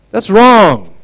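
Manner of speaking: high, loud indignant cry; indignant outcry. Real, not acted. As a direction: burst out in outrage. Outburst at the child's illness.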